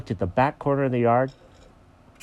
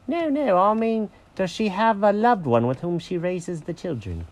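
high-pitched, politely explaining cat; clarify delicately in a high cat voice; careful, high character voice; prim Jenkins the cat; 8 semitones higher than usual. Acted.

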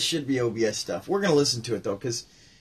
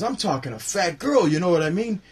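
raised, louder stand-up delivery; Rodney joke voice; 5 semitones higher, 3 dB louder than usual. Retold or imitated. Quoting the comedian's set to viewers, raised. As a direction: punch each line out. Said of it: "He goes".

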